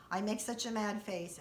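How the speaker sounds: ordinary talk, nothing marked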